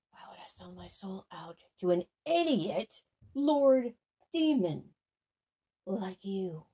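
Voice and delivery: hissed under the breath; sneaky whispered jab at the demon; whispered insult. Real, not acted. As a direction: whisper the taunt so he can't hear.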